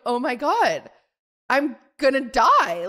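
slow, drawn-out dawning realization; slowed-down thoughts. Retold or imitated. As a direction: stretch it out as thoughts slow in panic. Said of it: "going slow".

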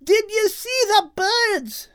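high, loud, slow cry; flustered shout. Acted. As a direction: shout in exasperation. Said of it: "Kate shouted again, feeling flustered".

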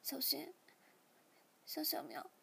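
hushed near-whisper to pets; small soft voice. A narrator's reading. 小喵 speaks to her two kittens, in a small hushed voice. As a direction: call them softly, almost whispering. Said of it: "小喵细声对小猫说".

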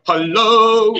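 belted out loud Hello; loud hello demo. Shown as a demonstration. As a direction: show her how to say Hello loudly. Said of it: "You've got to speak up loud".

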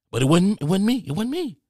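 high-pitched, defensive denial; squeaky-high plea of not me; protesting innocence. Acted. Protesting he caused no violence.

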